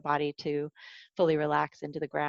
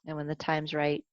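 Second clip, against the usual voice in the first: guided meditation. Acted. Teacher guiding a body scan for the class, calm meditation voice. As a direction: ease listeners down into the body, gently.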